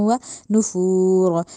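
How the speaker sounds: slow recitation with heavy r in नुफूर; Quran recitation demo. Shown as a demonstration. Tajweed teacher reciting for students, slow and heavy. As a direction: chant व नुफूर slowly, drawing it out. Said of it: "नुफूर में जो रॉ है उसको मोटा करना है".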